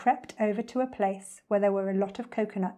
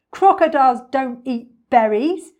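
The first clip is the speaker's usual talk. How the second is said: high, loud villain correction; emphatic storybook villain; scornful crocodile; 4 semitones higher, 11 dB louder than usual. Acted.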